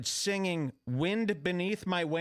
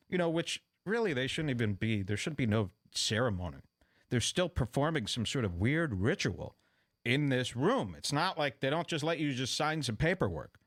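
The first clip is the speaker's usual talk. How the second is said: ordinary talk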